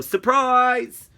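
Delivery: high, loud, gleeful call; spring it out high like a surprise; imagined surprise yell. A narrator's reading. Mum imagined jumping from behind curtain, yelling. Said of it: "and yell".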